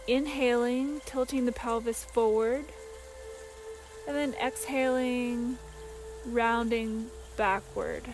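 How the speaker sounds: soft, unhurried meditation voice with long pauses; guided meditation. Acted. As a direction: guide them gently through each breath. Leads a breathing meditation.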